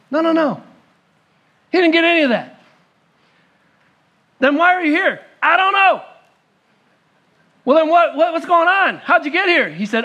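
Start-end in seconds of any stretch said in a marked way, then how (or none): 0.1-5.2 high and loud, astonished interrogation; incredulous questioning. Retold or imitated. Heavenly gatekeeper questions the thief. 5.4-6.0 high, sheepish thief's reply; voiced thief. Thief answers at heaven's gate. 7.6-9.7 high, louder astonished questioning; pressing questions. Retold or imitated. Gatekeeper presses the thief.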